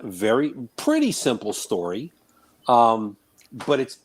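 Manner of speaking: usual speaking voice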